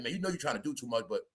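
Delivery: low, fast, soft grumble imitating [name]; mock [name]. [name] muttering to [name] that she's doing too much, low and quick. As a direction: swallow the annoyance and let it go.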